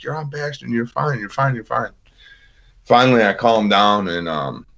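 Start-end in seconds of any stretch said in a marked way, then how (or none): 0.0-1.9 softly reassuring, imitating the medic; calming medic. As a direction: hush him down gently, over and over. Medic calming a panicked patient.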